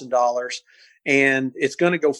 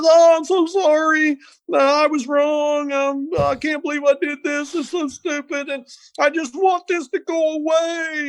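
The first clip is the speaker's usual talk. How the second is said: high, wailing fake cry; mock sobbing; 12 semitones higher than usual. Suspect wailing to the game wardens in a high put-on fake sob. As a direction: imitate a grown man blubbering on the porch. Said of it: "just feigning like he's crying his head".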